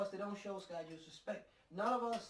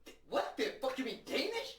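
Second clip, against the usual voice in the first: mock incredulous; 6 semitones higher, 4 dB louder than usual. Imitating incredulous Danish listeners.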